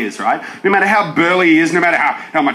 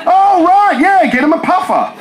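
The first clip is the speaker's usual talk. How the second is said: 1 casual bystander; 11 semitones higher, 3 dB louder than usual. Pretend. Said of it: "the word should just be".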